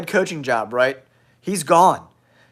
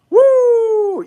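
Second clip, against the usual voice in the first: high, loud whoop; loud woo; 6 dB louder than usual. Real, not acted. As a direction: let out a big whoop. Ironic cheer over upset.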